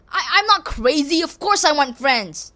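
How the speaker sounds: ordinary talk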